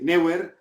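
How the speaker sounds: Neuer said NEH-wer; colleagues' pronunciation. Retold or imitated. Narrator imitates his colleagues, Hispanicized pronunciation. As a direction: say Neuer Spanish-style, "NEH-wer", r sounded. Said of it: "lo pronunciaban así".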